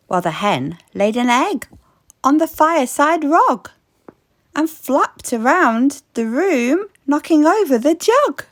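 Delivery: ordinary delivery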